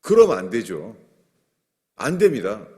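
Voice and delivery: loud, firm refusal; emphatic loud rebuke; blunt modern scolding. Retold or imitated. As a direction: shut it down like you're scolding a friend. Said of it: "요새 말로 그런 거예요".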